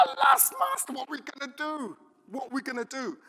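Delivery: high, frantic cry of alarm; panicked servant. Retold or imitated. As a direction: play the terrified servant crying out. Panicked servant in retold story.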